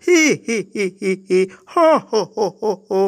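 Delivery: hee hee ho ho cackle; mocking laughter. Laughing at the small cloud.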